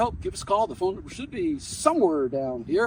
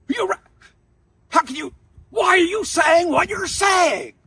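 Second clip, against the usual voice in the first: loud, much higher furious shout; angry professor; 13 semitones higher, 9 dB louder than usual. Retold or imitated. Furious professor shouting at the student over his changed answer. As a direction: explode at the student's flip-flopping. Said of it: "he's getting angrier and angrier".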